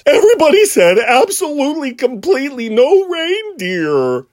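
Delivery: silly cartoon reindeer squeaking out his complaint; Sven's voice. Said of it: "he sounds like this".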